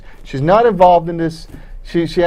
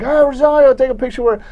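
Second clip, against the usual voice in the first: excited fans calling; 8 semitones higher than usual. Retold or imitated. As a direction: call out eagerly like fans spotting a star.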